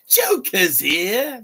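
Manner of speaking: high, loud, drawn-out villain singsong; theatrical high-pitched villain entrance; Joker. Shown as a demonstration. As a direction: stretch it way up high, very slowly. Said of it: "Joker's not supposed to have a deep voice".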